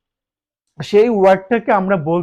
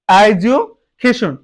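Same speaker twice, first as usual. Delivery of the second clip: Education slow, broken as "edu, cation"; split into parts; much slower than usual. Shown as a demonstration. Teacher showing an example word, education split as "edu, cation". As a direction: pause inside education before the last two syllables. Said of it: "এটাকে আমরা ভাগ করলে".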